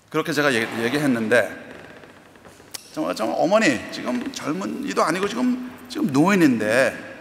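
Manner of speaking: usual speaking voice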